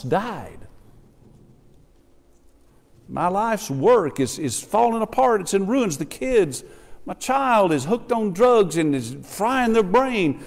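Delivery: desperate prayer. Acted. Voicing a desperate prayer to God.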